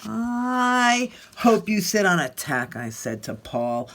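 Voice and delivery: put-on lively read-aloud voice for children; expressive picture-book reading; story narration. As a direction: tell it like Alexander grumbling through his day.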